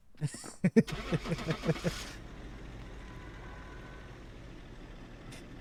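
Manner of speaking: long, high laugh that fades; real laughing fit. Real, not acted. Player cracking up at the too-aware ghosts, long high laugh. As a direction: keep laughing until the breath runs out.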